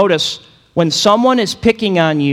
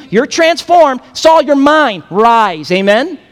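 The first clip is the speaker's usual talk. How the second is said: higher, louder, building fervor; fervent preaching; 7 semitones higher, 4 dB louder than usual. Acted. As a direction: lift Saul up with rising passion. Jesus voiced raising up Saul.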